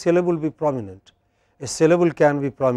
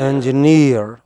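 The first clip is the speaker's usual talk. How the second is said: "Engineer" slowly, with a long, loud NEER; final-syllable stress; 5 dB louder, slower than usual. Shown as a demonstration. Teacher confirms the word for students, stressed on last syllable. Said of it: "What is this word?".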